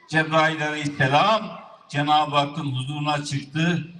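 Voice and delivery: ordinary delivery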